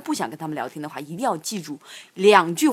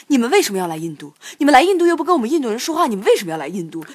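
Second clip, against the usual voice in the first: 学小贩连珠炮似地质问; 忽高忽低、急促地假装质问; 模仿怒声质问; 6 semitones higher, 5 dB louder, faster than usual. Retold or imitated. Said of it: "翻译成中文就是这样子的". (急冲冲地模仿质问)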